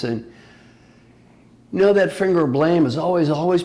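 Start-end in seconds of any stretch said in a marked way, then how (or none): none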